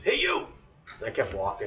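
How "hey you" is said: high barked call across the room; drill sergeant yell. Retold or imitated. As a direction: bark it like a Marine recruiter. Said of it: "he goes".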